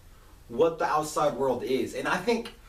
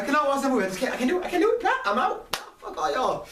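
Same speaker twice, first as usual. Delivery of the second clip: much higher, squeaky mock protest; mock refusal; 11 semitones higher, faster than usual. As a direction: pitch it way up, climbing as you refuse. Acting out refusal to watch.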